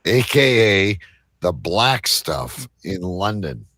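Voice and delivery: ordinary delivery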